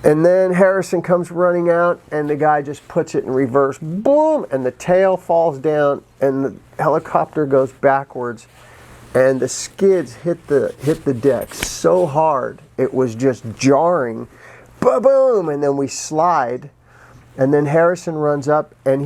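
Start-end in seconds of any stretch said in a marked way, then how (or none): 3.8-4.5 sharp high "boom" sound effect; imitated crash boom. Retelling the helicopter jolting backwards, mimicked crash boom. 14.8-15.5 high punchy "ba-boom" sound effect; imitated thud. Describing the skids hitting the deck, mimicked impact ba-boom.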